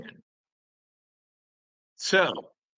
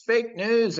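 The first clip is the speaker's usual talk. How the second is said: mocking freaking out; 9 semitones higher than usual. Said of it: "all the liberals are freaking out like".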